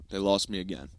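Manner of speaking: ordinary delivery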